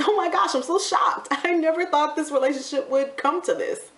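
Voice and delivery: high, mock-amazed gasp of fake surprise; playing at being stunned, dripping sarcasm; sarcastic shock. Real, not acted. To her viewers, mocking the obvious ending with sarcastic shock.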